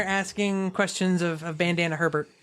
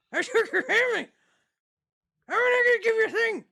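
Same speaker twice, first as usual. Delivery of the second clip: much higher, faster garbled thug; high hurried bandit; 11 semitones higher, faster than usual. Acted. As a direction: gabble it like a thief. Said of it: "he's like".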